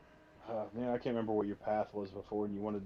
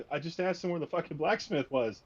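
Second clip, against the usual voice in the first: higher-pitched, exasperated disbelief; acted protest, pitched up and incredulous; indignant protest; 6 semitones higher, 4 dB louder than usual. Player protesting to a bad game master, indignant.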